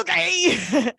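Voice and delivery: high, loud retold okay; mock exasperated okay. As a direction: squeal it over a crying baby. Retold reaction to wailing baby.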